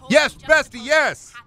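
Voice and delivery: loud, high barked drill-sergeant yell; military drill shout. Retold or imitated. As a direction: bark it like boot camp. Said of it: "Saying bestie like a military training academy".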